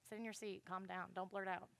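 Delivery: low, hushed mock reprimand; soft, subdued telling-off; imitated scolding. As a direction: quietly imitate someone shushing a student. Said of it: "I'm used to people like".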